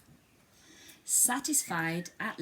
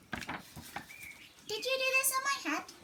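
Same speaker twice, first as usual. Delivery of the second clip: slow squeaky mole voice; a small mole asking patiently; high squeaky mole, slow; slower than usual. Acted. Mole asking the cow, high squeak, slow. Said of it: "he asked the cow".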